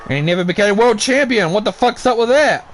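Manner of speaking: ordinary delivery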